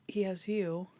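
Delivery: soft whispered line; gently acted, quiet reply; hushed tender quote. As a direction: whisper it simply, as a touching reveal. Quoting Ambrose's tender reply.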